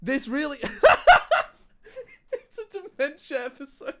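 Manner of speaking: high laughing, losing it; helpless high laughter. Real, not acted. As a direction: crack up at yourself. Cracking up at forgetting words.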